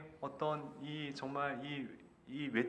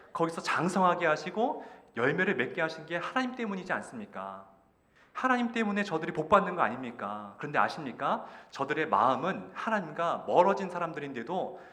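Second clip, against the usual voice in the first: raised, higher-pitched acted protest; impassioned outcry to God; 5 semitones higher, 4 dB louder than usual. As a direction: plead angrily with God, pushing each question. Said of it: "이렇게 절규하는 거예요". (하나님께 따지듯 절규하는 연기)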